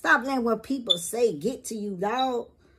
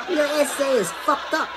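laughing quote; 5 semitones higher than usual. Retold or imitated. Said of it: "Mexican people".

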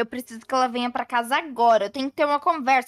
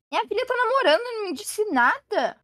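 higher, stunned disbelief; shocked; 8 semitones higher than usual. Acted. Aunt hearing her daughter is secretly dating, shocked. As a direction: gasp it out, can't believe it.

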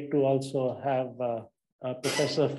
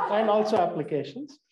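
amused, chuckling through it; laughing; 5 semitones higher, 4 dB louder than usual. Real, not acted. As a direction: repeat it with a laugh. Host laughs while accepting correction.

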